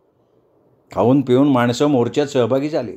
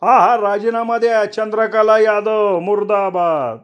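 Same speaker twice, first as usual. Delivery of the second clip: higher protest chant, the last part slow; a heckler calls out for her resignation; crowd heckle; 8 semitones higher, slower than usual. Acted. कोणीतरी ओरडलं.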